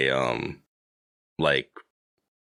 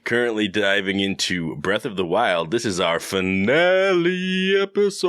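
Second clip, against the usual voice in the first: higher, upbeat show opening; podcast host intro; 4 semitones higher than usual. Acted. Host opening the finale episode, announcer style. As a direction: announce it, stretching the last word high and long.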